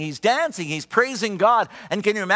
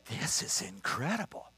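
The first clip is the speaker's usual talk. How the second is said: much softer, breathless wonder; awed hush; 6 semitones lower, 13 dB quieter than usual. Retold or imitated. Pastor standing behind the healed woman, hushed in awe. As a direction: let the awe take your voice down.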